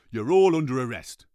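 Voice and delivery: a cat laying down the law; stern cat. Acted. Malcolm the cat voiced.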